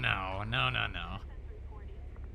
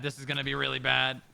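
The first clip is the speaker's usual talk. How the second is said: usual speaking voice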